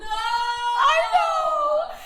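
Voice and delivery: high, loud, half crying laugh; laughing wail. Real, not acted. Laughing wail over sad scene.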